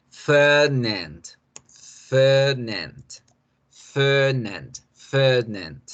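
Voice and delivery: Fernand with a long er and open English a; native English pronunciation. Shown as a demonstration. Teacher models Fernand for learners, native English pronunciation. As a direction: stretch Fernand slowly, as an English speaker would. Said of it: "como una persona angloparlante, como una persona nativa".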